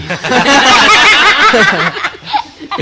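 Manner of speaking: high rising laugh; laughing fit over the aunties' quarrel. Real, not acted. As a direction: giggle up into a peal. Teller laughs at own joke.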